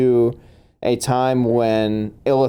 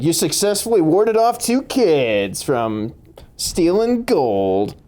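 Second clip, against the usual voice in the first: slow, high, drawn-out game-show proclamation; mock-heroic announcement; 7 semitones higher, slower than usual. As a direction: proclaim it grandly, stretching the last words. Game master announces achievement in mock grandeur.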